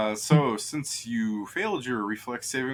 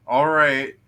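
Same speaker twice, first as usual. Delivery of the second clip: loud, raised acted reply; mock ready agreement; 4 semitones higher, 11 dB louder than usual. Enemies reacting to bad javelin throws, loud acted reply. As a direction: pipe up loudly as the enemies. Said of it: "So they're like".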